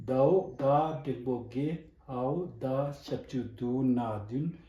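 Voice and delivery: normal talking voice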